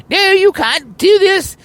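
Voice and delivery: very high falsetto, slow and possessive; ranting Mighty Python. Pretend. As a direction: whine and rage like a jealous rival, way up high. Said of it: "rant and rave".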